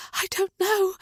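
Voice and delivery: ordinary delivery, nothing marked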